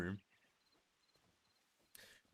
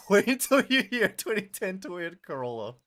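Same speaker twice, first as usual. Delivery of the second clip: loud, higher, through laughter; laughing; 8 semitones higher, 10 dB louder than usual. Real, not acted. Streamer teasing the chat about a Toyota Corolla, laughing. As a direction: say it loudly while cracking up.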